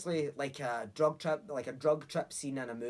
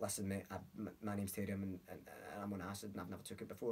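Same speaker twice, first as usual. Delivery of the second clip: softer, lower, nervous mumble; timid confession; 6 semitones lower, 10 dB quieter, faster than usual. Retold or imitated. Telling a stranger on the bus he's on acid, timid and hushed.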